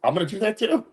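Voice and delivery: high, bouncy retold reply; mock eager. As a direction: sell it like a kid with a plan. Retelling his own eager reply.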